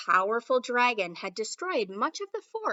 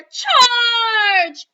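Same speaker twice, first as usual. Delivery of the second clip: much higher, shouted charge; battle cry; 11 semitones higher, 15 dB louder than usual. Acted. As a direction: yell the charge like a knight.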